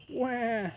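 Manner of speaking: put-on high, wailing baby cry; shrill infant "wah". A narrator's reading. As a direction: wail like a hungry newborn. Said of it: "yelled the baby ghost".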